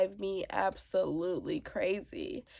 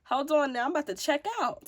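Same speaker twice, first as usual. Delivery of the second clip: overwhelmed mom; 5 semitones higher than usual. Retold or imitated. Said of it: "crying because I'm like".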